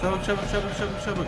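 being chased. Real, not acted. Fleeing a monster in game.

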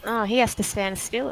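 ordinary talk, nothing marked

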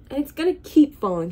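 finally can't hold it in and shouts. Acted. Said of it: "he shouted".

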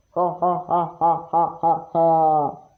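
slow, low villain cackle; evil laugh. Pretend. Villain answers phone, laughs. (kötü adam gibi yavaş, alçak kahkaha)

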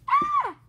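short, high, loud yelp; acted cry on being kicked; pained scream. Sudden high shriek.

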